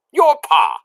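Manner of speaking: put-on loud, high call to a half-deaf girl; shouting soldier. A narrator's reading. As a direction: shout it so she can hear. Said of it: "shouted the soldier".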